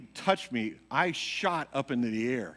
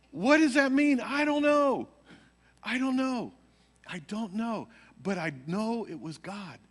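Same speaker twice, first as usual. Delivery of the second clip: excited retelling; 9 semitones higher than usual. Retold or imitated. Retold dazed back-and-forth.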